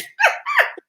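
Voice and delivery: high-pitched laugh; real, high giggling laughter. Real, not acted. Laughs after greeting co-host.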